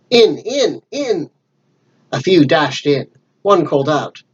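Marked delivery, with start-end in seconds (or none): 0.1-1.3 higher, urgent furious command; burning with rage, drive them inside; the blind man furiously orders the pirates into the inn; raging cry. Acted. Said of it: "voice rang out, as if he were afire with rage".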